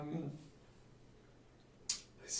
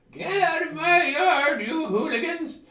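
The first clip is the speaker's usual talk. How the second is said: high, loud grumpy hermit shouting "get out of my yard"; angry old hermit yell; 13 dB louder than usual. Acted. Hermit shouting at trespassers, high and loud. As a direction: shoo the kids off his lawn, loud and high. Hermit NPC yelling at players.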